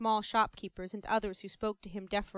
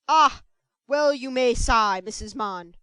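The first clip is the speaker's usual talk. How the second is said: pompous sympathy; 10 dB louder, slower than usual. Acted.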